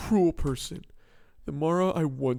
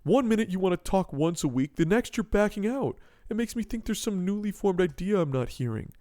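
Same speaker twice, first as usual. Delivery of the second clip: ordinary delivery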